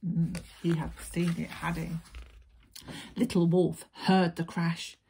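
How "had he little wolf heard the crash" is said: ordinary delivery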